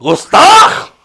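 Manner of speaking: high, loud mock wrath easing at the end; angry. Comic holy man threatens the host who asked his name, angry outburst. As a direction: thunder a hammy curse. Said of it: "इतना गुस्सा करने वाली कौन सी बात".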